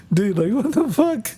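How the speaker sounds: high, incredulous squeak of disbelief; cracking up at a buddy's insult, high and stunned; stunned laughing disbelief. Retold or imitated. Retelling his reaction to a friend's crude insult, high incredulous laugh.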